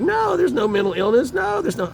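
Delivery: high, sing-song denial; mocking mother. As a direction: mimic her flat-out refusal in a squeaky voice. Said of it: "she's like".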